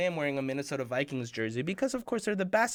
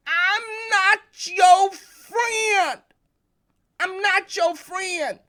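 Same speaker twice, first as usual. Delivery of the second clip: much higher, loud and very slow, drawn-out yell; furious shout; 14 semitones higher, 9 dB louder, much slower than usual. Retold or imitated. Boy shouts at his brother who took the bat, slow furious yell. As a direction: hurl it at him in a rage. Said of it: "I was angry and I was furious".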